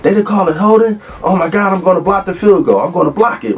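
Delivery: high, strained, worked-up player voice; imitating an outraged teammate swearing he'll block the kick; hysterical, pissed-off teammate. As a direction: mimic a furious lineman venting on the field. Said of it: "he's hysterical".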